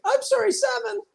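extremely high falsetto punchline; peak mock apology. Indonesian refusing to be outdone, falsetto mock apology. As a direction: squeak it out as the most desperate apology.

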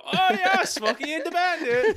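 high, loud put-on foreign accent; bad Turkish accent. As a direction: cheer it in a hammy Turkish accent. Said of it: "He just did a bad Indian accent".

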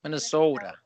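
imitated Minnesota accent with a long, round O; lightly exaggerated Minnesota twang. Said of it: "I don't say it like that".

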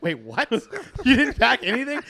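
can't believe he packed nothing; hammed-up retold shock; shocked disbelief. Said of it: "I'm like".

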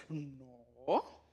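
small, low, quiet little no; a timid fib; sheepish child's no. Retold or imitated. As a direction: mumble it like a caught kid. Child caught, denies standing up.

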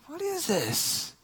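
high, slow, softened mock exasperation; fuming, retold. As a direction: drag it out in hushed comic outrage. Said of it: "I'm, like, fuming".

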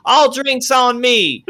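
high, loud, slow proclamation; patron announcing. Acted. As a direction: announce it to the whole bar.

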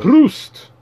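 high, loud mock Dutch toast; Dutch accent toast.

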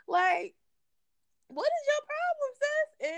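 very high-pitched mock confrontation; mock exasperated. Confronting someone who asks for extra, mock exasperated. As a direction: pitch it way up, acting fed up.

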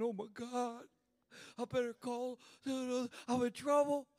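a hint of whiny panic, slow at the end; lightly mock a scared believer; mock crying. Preacher mocking believers who crumble at home, mock whine. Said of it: "we start crying".

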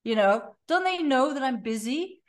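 high, whiny complaint to herself; high-pitched indignant inner voice; exasperated inner whine. Retold or imitated. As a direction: moan it like you're put-upon and overloaded. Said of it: "it's like".

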